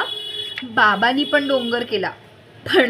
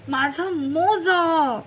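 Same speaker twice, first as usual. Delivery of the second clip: slow, drawn-out whining; slow whine; 5 semitones higher, much slower than usual. A narrator's reading. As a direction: stretch it into a whine.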